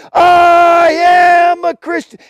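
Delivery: long drawn-out, high shout; acted martyr crying out while being crushed. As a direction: bellow it to the crowd with the last strength. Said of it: "as he's crying out".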